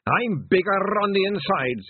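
high, loud, affronted brogue; indignant Scottish elder. Acted. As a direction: scold the girl as a proud old Scot.

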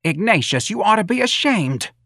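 high-pitched maternal rebuke; angry scolding. A narrator's reading. Mrs. Riley shaming her son, angry. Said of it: "Missus Riley said in an angry voice".

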